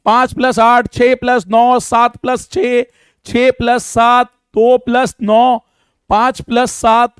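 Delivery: drawn-out sums for students to copy; slow dictation. Shown as a demonstration. Teacher dictating new sums for the class to solve. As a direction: dictate each sum slowly and clearly. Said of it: "एक बारी फटाफट इनको भी करो".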